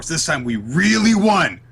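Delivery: high, loud hammed-up victory cry; mock triumphant insistence. Host acts out Noelle declaring victory, hammed-up triumphant.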